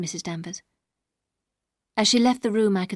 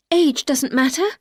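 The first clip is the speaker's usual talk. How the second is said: earnest plea; 4 semitones higher, 6 dB louder than usual. A narrator's reading. Young wife pleads with husband.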